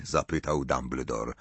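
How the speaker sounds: plain reading voice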